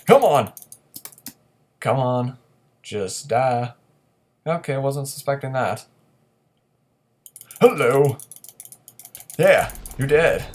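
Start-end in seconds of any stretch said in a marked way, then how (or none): 0.1-0.5 high, loud come on; loud exasperated yell; frustrated shout. Real, not acted. Frustrated at fleeing enemy. 7.6-10.5 loud, high, slow taunt; triumphant yell; gloating shout. Real, not acted. Ambushing enemy, gloating.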